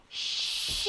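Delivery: She drawn out in a soft breathy hush; slow and hushed. Shown as a demonstration. A mom saying She slowly along with her daughter. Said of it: "we'll say she".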